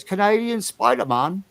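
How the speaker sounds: lightly imitating Carl's delivery; a hint of Carl's voice. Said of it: "or as Carl says".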